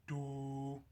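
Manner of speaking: "do" held long on one steady note; a drawn-out, even "do"; moan-like do sound. Shown as a demonstration. Coach showing the moan murmur, long do sound. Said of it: "more of a moan sound".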